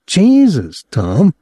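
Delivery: a touch of wounded reproach, pitched high; reproachful. A narrator's reading. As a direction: scold a friend for hurting you. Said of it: "Claude said reproachfully".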